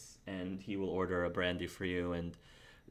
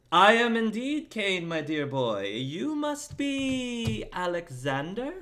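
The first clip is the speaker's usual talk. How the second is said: high, slow noble gentleman's voice; Kane's voice; 9 semitones higher, 5 dB louder than usual. Acted. GM as Kane greeting Alexander, high courtly voice. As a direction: greet the young knight with grand courtesy.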